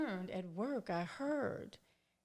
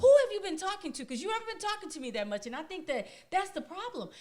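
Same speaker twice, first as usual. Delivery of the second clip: retelling his upset, higher and louder accusing questions; upset husband; 7 semitones higher, 6 dB louder than usual. Retold or imitated. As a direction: act out the husband getting worked up, pressing each question. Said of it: "Oh, he got so upset with me".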